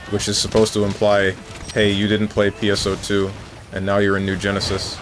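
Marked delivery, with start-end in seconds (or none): none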